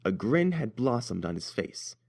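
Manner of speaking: plain reading voice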